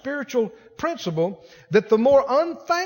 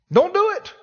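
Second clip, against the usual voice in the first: higher louder warning; 9 semitones higher, 4 dB louder than usual. Acted. Repeated warning, second louder.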